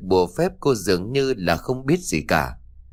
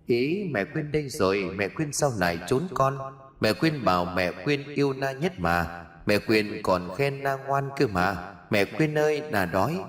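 put-on soft, eerie little-girl singsong; Na's chirping child voice; 6 dB quieter than usual. Na finds Quyên hiding, softly chirping. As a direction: quietly wheedle like a ghost child. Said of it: "bé Na cất giọng lanh lành".

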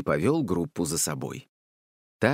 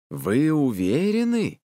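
slow, raised, booming question; thundering voice; 5 semitones higher, 3 dB louder, slower than usual. A narrator's reading. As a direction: boom it out, drawing out the words. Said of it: "спросила Зоя громовым голосом". (громовой протяжный голос)

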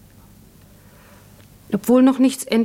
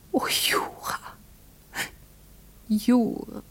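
breathy whisper; 4 semitones higher, 6 dB quieter, slower than usual. Acted. Closing words of farewell letter.

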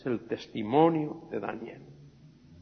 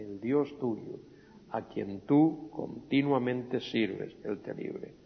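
soft, slow, fading to a whisper; hushed repeat; 6 dB quieter, slower than usual. Shown as a demonstration. Preacher repeats the king's words to the congregation, hushed. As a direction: let it sink to a breath. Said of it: "same words repeated quietly".

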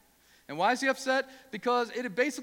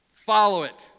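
loud urging; 10 dB louder, slower than usual. Real, not acted. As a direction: punch follow it loudly. Preacher urging the congregation.

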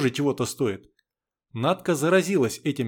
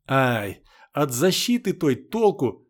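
usual speaking voice

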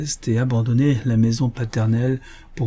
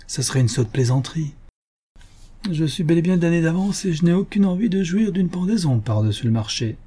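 forced joking; 7 semitones higher than usual. A narrator's reading. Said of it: "fit-il d'un ton badin, mais forcé".

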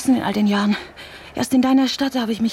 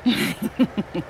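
scornful laugh; 3 dB quieter than usual. Acted. Scornful reply on the phone.

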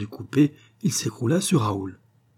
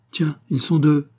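louder, higher surprised remark; startled exclamation; exclaiming; 5 semitones higher, 5 dB louder than usual. A narrator's reading. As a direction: exclaim at the discovery. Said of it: "s'exclama-t-il".

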